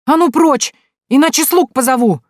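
high, loud barking order; barked threat. Acted. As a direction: bark it to drive him off. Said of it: "рявкнула она". (рявкнуть, грозно)

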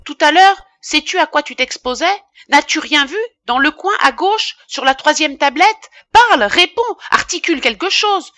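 high, loud, insistent questioning; angry interrogation. A narrator's reading. Apothecary interrogates his frightened apprentice, angrily insistent. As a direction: press the culprit for an answer.

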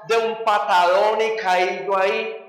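usual speaking voice

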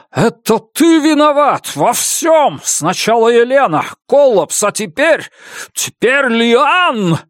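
furious accusing shout. Acted. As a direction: hurl the blame at him in rage. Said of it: "злобно уставился на Баэля".

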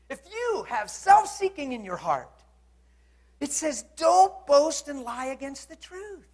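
high, loud, weighted delivery; forceful preaching. Real, not acted. Preacher warning listeners about envy in the heart, forceful preaching. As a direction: drive it home to the congregation.

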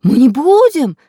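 loud, high protest of innocence; eager promising robbers. A narrator's reading. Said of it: "затрясли головами разбойники".

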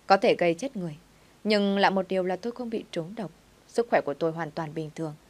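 plain reading voice

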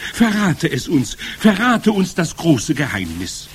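high, loud pleading; eager calling. A narrator's reading. Witch audience pleading with their leader, eager calling. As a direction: beg loudly for the secret. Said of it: "riefen sie".

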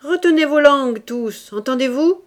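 host yelling at his guests in a fit of rage; angry shout. A narrator's reading. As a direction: snap at the whole table to shut up. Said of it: "Cria mon amphitryon dans un accès de colère".

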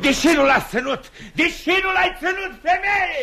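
high, loud blame hurled at the wife; angry shout. Acted. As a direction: shout the accusation. Scolding wife for letting go. (strigăt furios către soție)

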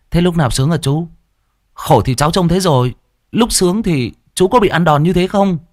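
put-on high, teasing boy's voice; cheeky nephew. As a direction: poke fun at the uncle innocently. Boy teases uncle.

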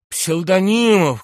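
high, loud, drawn-out name; desperate calling out. A narrator's reading. General reaches out to his subordinate, desperate calling out. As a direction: stretch the name into a cry for help. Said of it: "кричал Иван Ильич".

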